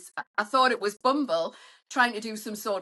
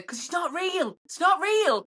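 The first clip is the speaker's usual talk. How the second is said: bust the fake profile with raised, mocking certainty; mock exposé; 7 semitones higher than usual. Dater calling out a blurred fake profile, high and scoffing.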